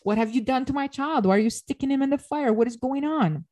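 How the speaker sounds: high, panicked questions, retold; freaking out. Queen confronting Demeter about the child, panicked and high. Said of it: "the queen is freaking out".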